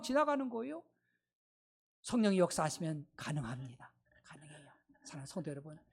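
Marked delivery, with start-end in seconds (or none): 2.0-5.8 breathy hushed whisper of assurance. Acted. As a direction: lean in and whisper the promise. Intimate moment in sermon. (속삭이듯 확신을 전하는 목소리)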